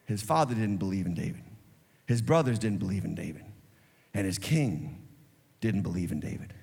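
low, soft and grave; solemn hush. Real, not acted. Preacher stressing nobody believed in David, low and soft. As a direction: drop to a heavy hush for weight.

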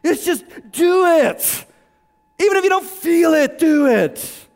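loud, high, drawn-out exhortation; full-force preaching. Real, not acted. Preacher urging the congregation to do it, full-force preaching. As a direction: belt it out to the congregation.

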